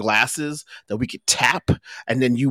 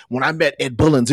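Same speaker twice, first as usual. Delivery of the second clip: ordinary talk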